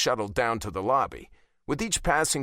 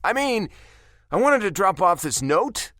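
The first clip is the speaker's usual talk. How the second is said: awkward stammer; 8 semitones higher, 5 dB louder than usual. Acted. Awkward character dialogue.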